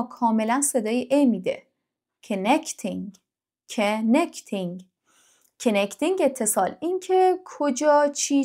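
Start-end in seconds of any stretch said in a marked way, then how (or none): 2.3-6.2 connecting said slowly, each sound clear; teacher drawing out connecting for learners; slow clear connecting for the class; model pronunciation. Shown as a demonstration.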